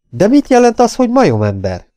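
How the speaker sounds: put-on high, loud girl's exclamation; kiáltás. A narrator's reading. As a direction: cry out the question, starting very high. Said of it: "kiáltotta a lány". (magas hangon felkiáltva)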